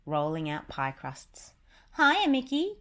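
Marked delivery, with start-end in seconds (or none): 2.0-2.8 high, louder cartoon greeting; cheery Goofy. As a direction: call out a happy hiya. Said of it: "Goofy said".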